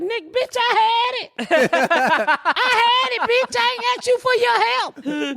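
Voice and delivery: squeaky, high indignant cat. Retold or imitated. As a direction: voice the cat offended at being rescued. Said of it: "The cat like".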